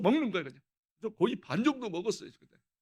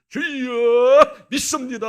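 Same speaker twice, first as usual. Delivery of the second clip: fervent prayer; 5 semitones higher, 16 dB louder, much slower than usual. Retold or imitated. Praying before the pork.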